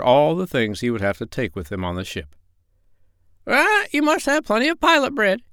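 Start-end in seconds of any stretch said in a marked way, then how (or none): 3.5-5.4 very high, squawky parrot voice; squawking parrot voice. Acted. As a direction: squawk it out like a bossy old parrot. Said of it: "she said".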